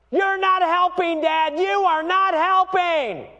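very high, drawn-out mock yell; yelling daughter. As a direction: imitate an upset child yelling at dad. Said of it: "then she yells at me".

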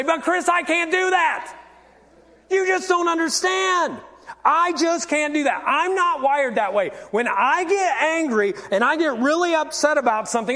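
protesting churchgoer. Acted. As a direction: plead that you just can't, hammed up. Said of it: "some of you are gonna say".

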